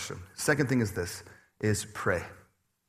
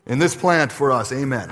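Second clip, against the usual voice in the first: mock prayer at work; 2 semitones higher, 9 dB louder than usual. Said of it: "you should do that at sappy".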